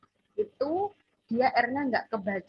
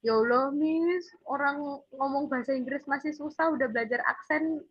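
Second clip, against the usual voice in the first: whining student; 4 semitones higher, 4 dB louder than usual. Retold or imitated. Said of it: "Wong kadang".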